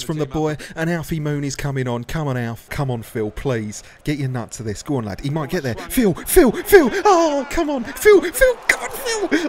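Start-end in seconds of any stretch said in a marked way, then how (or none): 5.8-9.5 much higher, cracking shouts of the name; voice breaking. Real, not acted. Commentator screaming his defender on, voice breaking. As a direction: yell so the voice cracks. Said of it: "My voice sounded like it was breaking then".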